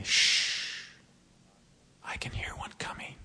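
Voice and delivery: hushed whisper, as if something is close by; a conspiratorial whisper in the dark. Retold or imitated. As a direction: breathe it so nothing hears you. Uncle hushes boys in dark.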